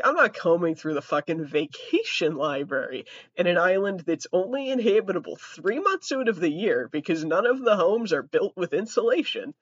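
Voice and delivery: ordinary talk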